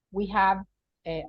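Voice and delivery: usual speaking voice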